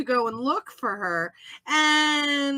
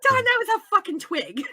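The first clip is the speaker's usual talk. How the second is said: much higher exclamation; mock indignant retort; 8 semitones higher, 3 dB louder, faster than usual. Host rehearsing what she'll tell Don, mock indignant. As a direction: crow it out, about to laugh. Acting out a future triumphant retort.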